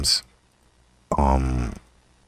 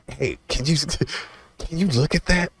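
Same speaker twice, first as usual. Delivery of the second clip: high pleading; 11 semitones higher than usual. Retold or imitated. Retold plea to a friend.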